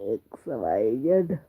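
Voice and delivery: letters dropped low and slowed, played as a joke; mock deep voice. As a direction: say x Y Z low and slow, like a gruff teacher. (X Y Z को मज़ाक में भारी, धीमी आवाज़ में)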